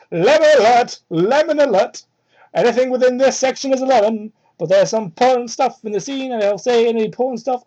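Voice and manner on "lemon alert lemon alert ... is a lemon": loud, mock-alarmed announcement of an explicit section; mock warning. Reader warns viewers of explicit content, loud mock alarm. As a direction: sound the alarm, hammed-up and loud.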